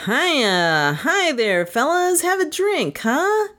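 high-pitched chummy barfly hailing company; high, climbing, needy bar-room cheer; lonesome cheer. A narrator's reading. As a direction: whoop a lonely, over-friendly greeting, voice soaring. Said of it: "hailed them with a loud and lonesome cheer".

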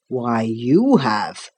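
low, drawn-out officer's reply; captain. A narrator's reading. Captain to a puzzled boy, slow and knowing. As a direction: answer slowly and low as the captain.

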